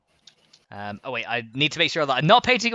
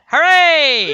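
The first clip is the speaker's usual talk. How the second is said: very high, loud hooray; cheering; 11 dB louder than usual. Pretend. Announcing the new fox, loud celebratory cheer. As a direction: cheer at full volume.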